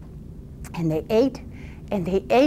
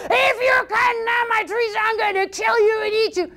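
threatening troll; 12 semitones higher than usual. Acted. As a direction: roar the threat at the boy, high and big.

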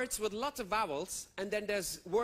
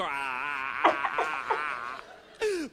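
1 mock sobbing; 7 semitones lower, 4 dB louder, much slower than usual. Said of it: "I would go".

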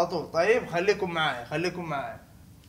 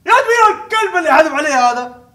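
higher, louder angry yell; angry outburst; 8 semitones higher, 11 dB louder than usual. Acted. At the one who threw something, an angry yell. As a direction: curse out whoever threw it.

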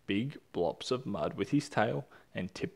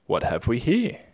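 curious inquiry; 8 dB louder than usual. Acted. Emu curious.

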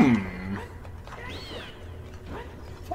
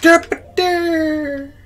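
goofy derp; 5 dB louder than usual. Pretend.

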